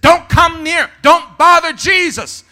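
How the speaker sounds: high, loud warding-off; acted rebuke. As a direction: shout them away sternly. Disciples turn the children away.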